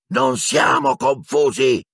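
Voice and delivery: high, slow, furious roar. A narrator's reading. Harry rejecting the professor's verdict, roaring. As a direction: roar it out word by word. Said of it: "ruggì Harry".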